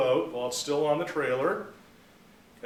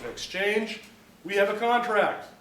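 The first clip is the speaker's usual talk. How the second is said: high-pitched, emphatic mock argument; insistent claim; 7 semitones higher, 3 dB louder than usual. Buyer telling the owner they're bound, acted insistence. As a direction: press the point, building louder.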